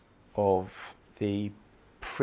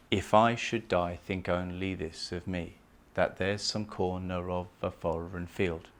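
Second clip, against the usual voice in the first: softer, measured iambic reading; poem recital; 4 semitones lower, 5 dB quieter than usual. Shown as a demonstration. Teacher reciting the sonnet to show the meter. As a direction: let the beats carry each line. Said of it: "That's iambic pentameter".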